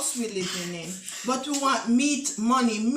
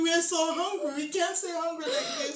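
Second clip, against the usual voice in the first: crying plea; 5 semitones higher than usual. Acted. Mother breaking into tears.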